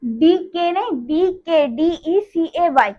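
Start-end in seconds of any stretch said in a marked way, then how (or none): 1.7-2.9 slow letter-by-letter spelling of डीईसीएवाई, D-E-C-A-Y; spelled out. Shown as a demonstration. Teacher spelling decay for her class, letter by letter, slowly. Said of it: "उसको हम लोग कहते हैं linear डीके".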